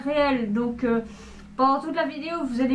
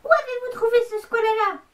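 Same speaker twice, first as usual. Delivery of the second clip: high demanding voice; 8 semitones higher than usual. Acted. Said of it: "je vais faire toutes les voix".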